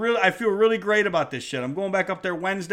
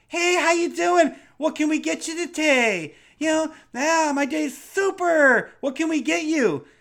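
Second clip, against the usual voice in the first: very high, chirpy put-on customer-service voice; exaggerated high-pitched greeting; mock weirdo barista; 11 semitones higher, 4 dB louder than usual. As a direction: gush like an over-cheerful barista. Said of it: "you get some fucking weirdo, some guy with purple hair".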